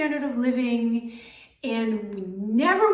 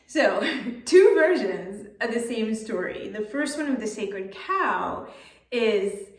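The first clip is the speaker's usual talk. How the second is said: ordinary delivery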